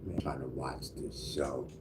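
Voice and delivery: soft whispered mutter; whispered aside. Real, not acted. Muttering to himself while watching the show. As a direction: whisper it to yourself.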